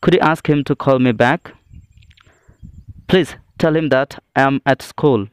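ordinary delivery

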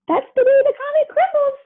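high, teasing singsong. Acted. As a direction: rub it in playfully. Speedy teases Pluto.

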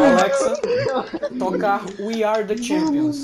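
very high, loud, slow; triumphant shout. Real, not acted. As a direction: call it out like a victory. Mock celebration command. (grito de vitória)

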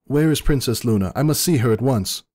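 high, commanding urgency; urgent princess. A narrator's reading. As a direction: demand it like a frantic royal. Celestia demanding Luna's whereabouts.

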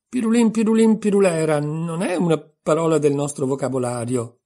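grave objection, high and loud on the nonsense words. A narrator's reading. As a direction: object firmly, with solemn insistence. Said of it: "replicò Alice gravemente". (replica grave e decisa)

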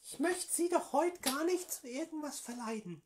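höhere, gespielte Politikerinnenstimme; Frauenstimme. Acted. Prank call as AfD woman. (verstellte Frauenstimme am Telefon)

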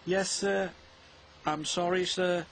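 high-pitched, small apology; contrite. A narrator's reading. Bill apologises to the Fat Controller, contrite. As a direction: own up to the boss.

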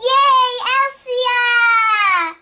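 loud high happy shout; excited cheer. Acted. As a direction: cheer her arrival. Friends greet Elsia arriving.

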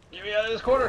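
loud frustrated yell. Real, not acted. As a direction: shout it out in frustration. Stuck in corner, yelling.

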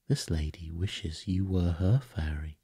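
ordinary delivery, nothing marked

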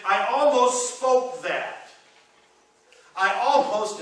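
loud, high, drawn-out admission; preacher's impassioned near-confession; emphatic confession. Acted. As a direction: push it out slow and forceful. Acting out psalmist's near outburst.

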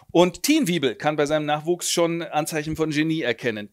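ordinary talk

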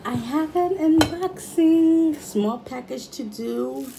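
loud, high, slow announcement; excited announcement. Real, not acted. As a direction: proudly announce the big news. Big announcement to viewers.

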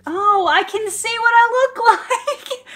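high, loud and slow, laughing through the words; gushing with real laughter at her reflection; laughing delight. Real, not acted. Sees her new red hair.

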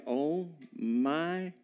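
slow, drawn-out stunned "oh my gosh"; stunned disbelief. Acted. Disciple seeing the naked man running at him, stunned. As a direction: let each word trail out in mock dismay. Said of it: "you're like".

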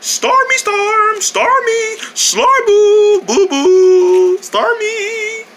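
very high, drawn-out teasing call; singsong calling. Pretend. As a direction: lure them out in a high singsong. Calling Storm to lure him.

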